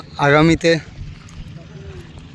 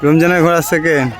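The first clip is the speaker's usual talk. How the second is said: race caller; 3 semitones higher than usual. Real, not acted. Live horse race commentary.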